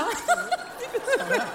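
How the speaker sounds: high burst of laughter. Real, not acted. Laughing at the water song.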